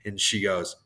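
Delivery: ordinary delivery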